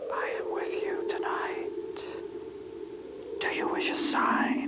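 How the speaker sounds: whispered spirit voice; ghostly whisper. Acted. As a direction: breathe it like a voice from beyond. Spirit answers at seance.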